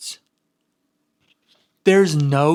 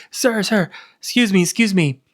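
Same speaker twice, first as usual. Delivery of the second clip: higher, louder call for attention; hailing an employee; 5 semitones higher, 4 dB louder than usual. Retold or imitated. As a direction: call out insistently to flag someone down. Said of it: "He hails an em-, employee".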